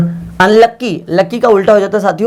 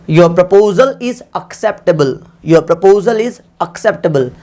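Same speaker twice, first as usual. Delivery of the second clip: slow dictation; much slower than usual. Shown as a demonstration. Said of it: "तो मैं कहूँगा".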